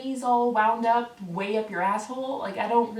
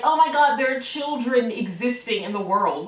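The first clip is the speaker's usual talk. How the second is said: mock horror; 2 semitones higher, 6 dB louder than usual. Mimicking commenters worried about children, mock horror. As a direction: play the scandalized complainer. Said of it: "this mindset that, like".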